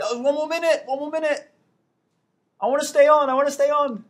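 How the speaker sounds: loud, high-pitched mock pleading; playful protest. Begging to stay on as time ends.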